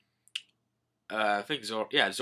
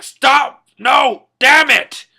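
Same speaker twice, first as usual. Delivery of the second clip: three high, full-volume shouts; hammed-up frantic protest; panicked shouting; 14 semitones higher, 18 dB louder, much slower than usual. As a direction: yell each word separately in mock horror. Acted Usopp shouts objection.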